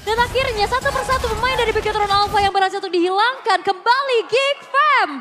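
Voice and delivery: high, loud hyped call; esports caster. Real, not acted. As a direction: yell the fight play by play. Hyped play-by-play of teamfight.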